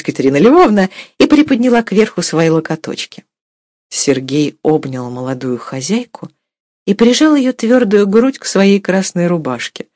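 plain reading voice

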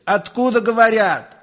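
high-pitched exasperated demand; irritated. A narrator's reading. Professor demands who is calling, irritated and high. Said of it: "раздражился Персиков".

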